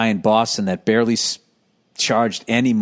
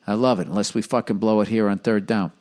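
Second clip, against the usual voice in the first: ordinary talk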